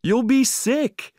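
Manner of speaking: high, slow warning call; alarmed cry. A narrator's reading. Owner warning dog drinking seawater, high alarmed cry. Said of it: "I cried".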